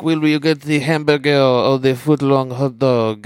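slow mock French accent, sneering; a light French accent, mocking the American's order; French accent of a rude maitre d' talking down; snooty French waiter. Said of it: "And he says, like".

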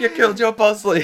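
high, loud wail through laughter; hammed-up, laughing cry of pain; mock agony. Co-host mimicking the dying friend, mock agony.

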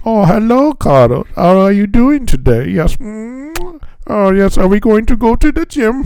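high, hammed-up fake German accent; a deliberately bad German accent to tease Carlos; horrible German accent. Imitating a friend who speaks with a German accent, in a bad German accent. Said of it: "That's my horrible German".

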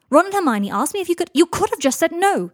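high, loud protest; heated argument. Acted. Harry and Draco arguing about the favour, heated. Acted quarrel, voices raised.

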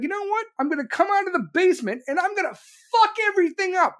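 high, mocking Biden voice. Comedian mocking Biden, pitched high and smug. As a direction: sneer it like a smug old man.

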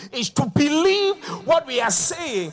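loud, high preacher's cry; preaching at full force. Real, not acted. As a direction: preach it out with joy. Preaching over music.

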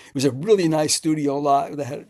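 usual speaking voice

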